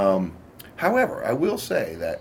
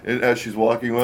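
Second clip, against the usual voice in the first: normal talking voice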